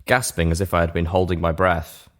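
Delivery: plain reading voice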